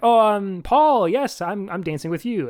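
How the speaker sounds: high, eager, flustered gush; suddenly thrilled and scrambling for words; mock flustered. Susan grabbing the rival as her partner, high and flustered. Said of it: "that dude walks by and she's like".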